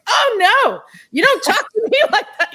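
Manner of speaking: high, loud sassy retort; high, loud, laughing don't-mess-with-me retort; sassy comeback. Retold or imitated. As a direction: put her firmly in her place, with a laugh. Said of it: "don't mess with me kind of an attitude".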